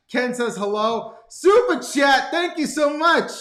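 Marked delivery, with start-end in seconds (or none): 1.3-3.3 loud, high, drawn-out announcement; excited shout-out. Real, not acted. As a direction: call out a big thank-you to a donor. Host calls out super chat thanks.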